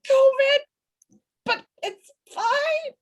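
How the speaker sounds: high pretend-calm voice, stretched slowly; mock cheerful. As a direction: squeak it out like everything is fine. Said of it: "I'm like".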